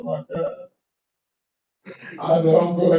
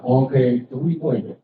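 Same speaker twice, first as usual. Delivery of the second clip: ordinary talk, nothing marked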